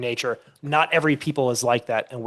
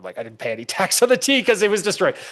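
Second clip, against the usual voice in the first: higher, quick, laughing through the words; playful imitation of a farmer laughing off the tea tax; mock laughing farmer; 6 semitones higher, faster than usual. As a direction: chuckle the answer away as the old Minuteman. Retold farmer laughing at question.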